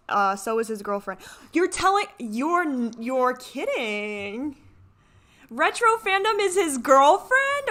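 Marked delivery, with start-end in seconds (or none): 1.5-7.7 high, loud, drawn-out disbelief; can't believe what she just read; stunned you're kidding; shocked disbelief. Real, not acted. Shocked at reveal of girlfriend.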